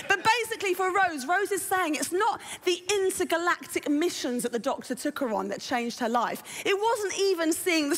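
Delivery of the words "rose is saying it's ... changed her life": usual speaking voice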